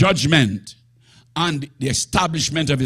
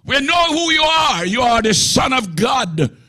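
possessed man defying Jesus, raised and higher; demon speaking; 8 semitones higher than usual. Acted. The devil talking in church, defiant and raised. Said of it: "He's testifying".

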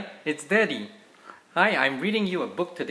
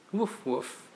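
a higher, lightly voiced woof woof; playful puppy bark; 5 semitones higher, 5 dB quieter than usual. Dad reading to his kids, lightly barking as the puppy.

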